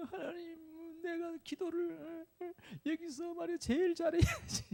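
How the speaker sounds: high, soft, drawn-out mock crying; tearful resentful complaint. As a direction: whimper a grievance to God, slowly. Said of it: "하나님을 원망하기 시작했어요".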